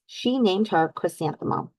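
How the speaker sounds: ordinary delivery, nothing marked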